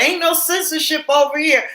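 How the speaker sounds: high, quick, defiant mock voice; sassy quote. Nurse quoting a defiant commenter in a sassy high voice. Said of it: "She was like".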